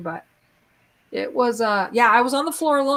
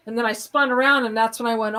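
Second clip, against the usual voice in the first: ordinary talk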